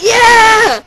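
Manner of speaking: high, loud cheer; fan cheer. Retold or imitated. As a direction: scream it with the crowd. Cheering along with the crowd.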